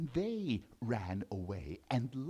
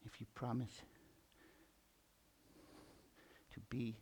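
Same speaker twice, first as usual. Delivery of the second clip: gentle mouse; 4 semitones lower, 6 dB quieter than usual. Acted.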